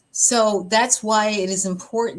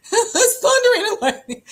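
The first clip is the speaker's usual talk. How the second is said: laughing mock panic; 13 semitones higher, 6 dB louder than usual. Mocking her old storm panic.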